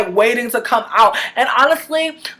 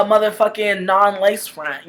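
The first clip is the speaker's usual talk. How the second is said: ordinary talk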